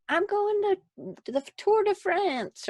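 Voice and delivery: hammed-up grand boast; grandiose mock announcement. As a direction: announce it like a big hero, slowly and up high. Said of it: "you don't just get up and go like".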